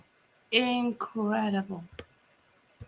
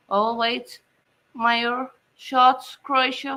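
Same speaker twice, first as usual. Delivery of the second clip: ordinary talk, nothing marked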